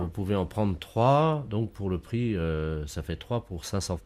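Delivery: off-mic sales talk, slow and distant; from another room. Acted. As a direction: speak away from the receiver, slowly. Seller talks away from phone. (voix lointaine loin du combiné)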